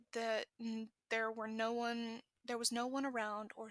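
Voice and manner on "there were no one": ordinary delivery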